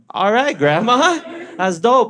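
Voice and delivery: high, slow mock approval; humoring. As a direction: pat grandma on the head with your voice. Family humoring grandma.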